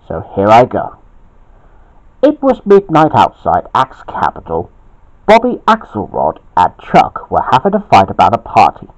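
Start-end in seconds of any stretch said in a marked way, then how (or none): none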